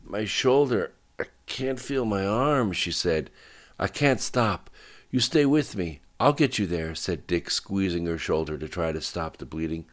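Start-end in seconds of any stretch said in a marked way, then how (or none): 0.0-2.7 higher-pitched, hurting complaint; distressed wounded cry; pained distress. Acted. Wounded woman reports her injury. 3.8-6.9 higher, anxious urging; tense promise in a raised pitch; urgent reassurance. Acted. Driver urges wounded partner on.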